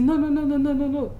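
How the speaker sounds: re-enacted flustered protest; acted frantic refusal. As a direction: wave off help in a fluster.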